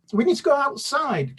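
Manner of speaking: high excited yell. A narrator's reading. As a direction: burst in yelling to the family. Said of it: "Kaz yelled".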